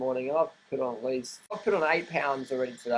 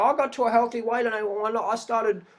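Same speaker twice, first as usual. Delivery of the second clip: higher, wistful mocking voice; higher mocking voice of someone who wants to be stick-thin; mock anorexic; 6 semitones higher than usual. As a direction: play a dreamy dieter, lightly sending her up. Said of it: "you've got the anorexics go".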